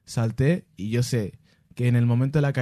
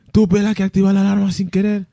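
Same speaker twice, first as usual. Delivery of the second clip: panicked call repeated, louder and higher; urgent calling; 8 semitones higher, 7 dB louder than usual. Retold or imitated. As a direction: plead loudly for help again. (llamada de pánico repetida, más alta)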